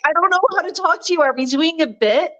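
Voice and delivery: a flustered, bewildered rush of questions; baffled. Retold or imitated. Recalling her confused reply to the posh woman, bewildered and fast.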